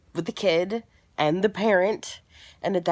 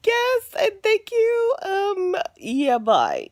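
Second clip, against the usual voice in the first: much higher, loud and squeaky; awkward reply; 14 semitones higher, 5 dB louder, much slower than usual. Retold or imitated. Thanking the other mom for congratulations, high and awkward. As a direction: chirp it out awkwardly.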